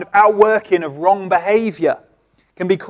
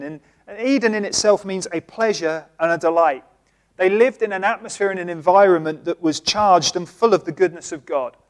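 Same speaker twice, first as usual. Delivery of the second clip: ordinary talk, nothing marked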